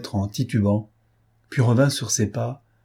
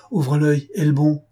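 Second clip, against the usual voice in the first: "ouvre l'œil, aie le bon" higher and punched; emphatic warning; 6 semitones higher, 3 dB louder than usual. A narrator's reading. Chief instructing his inspector, "ouvre l'œil, aie le bon" raised emphatically.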